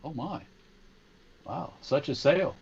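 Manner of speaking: dry, ironic mock amazement; sarcastic. Real, not acted. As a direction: feign being impressed by a so-called bargain. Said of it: "Is there irony in your voice?".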